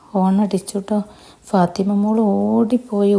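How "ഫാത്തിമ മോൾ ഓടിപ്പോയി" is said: plain reading voice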